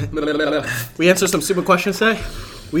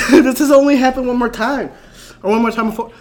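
laughing; 8 semitones higher, 5 dB louder than usual. Real, not acted. Laughing while mouth burns.

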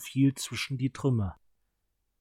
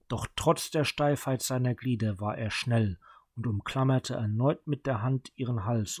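usual speaking voice

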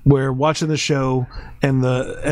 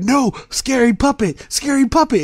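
imitating scared son; 11 semitones higher than usual. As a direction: whimper it like a spooked toddler. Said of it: "and he's like".

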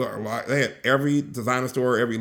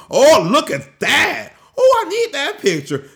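excited admiration; 11 semitones higher, 6 dB louder than usual. Real, not acted. Vlogger seeing a gorgeous shot, excited admiration. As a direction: exclaim in delight, pitch climbing.

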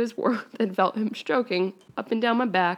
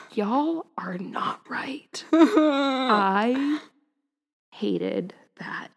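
slow, breathless whisper of disgust; appalled whisper; 2 semitones higher, much slower than usual. Real, not acted. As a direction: whisper it, still recovering. Reader recoils after finishing fanfic.

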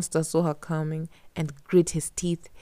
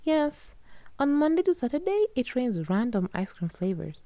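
Katya's voice; 8 semitones higher than usual. A narrator's reading.

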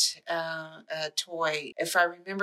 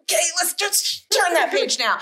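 high, louder hammed-up reading; hammy reading voice; 11 semitones higher, 4 dB louder than usual. Shown as a demonstration. Showing how she read the book as a kid, high and hammy. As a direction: read it like a giant ham. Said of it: "exactly how I would read that book".

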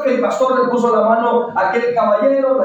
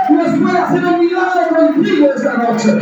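fervent preaching; 5 semitones higher than usual. Real, not acted. Pastor rousing the congregation, fervent high preaching. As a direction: lift the church up, preach it out.

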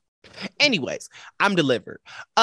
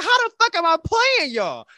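high, indignant squeal of a question; outraged disbelief; 13 semitones higher than usual. Retold or imitated. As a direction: throw it back at him, offended. Retold outraged reply.

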